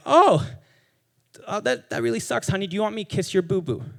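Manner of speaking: a light imitation of a parent soothing a hurt child, starting high; comforting parent. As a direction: coo at the kid like mom comforting a scraped knee. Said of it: "they're like".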